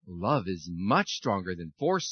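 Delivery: calm the wind down with a smile; smiling sun. A narrator's reading. Sun answering the boastful wind, slow and smiling. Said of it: "smiled the sun".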